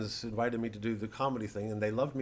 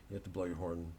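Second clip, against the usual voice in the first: normal talking voice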